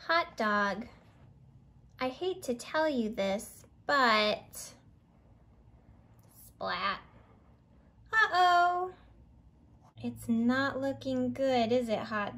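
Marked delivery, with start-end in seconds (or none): none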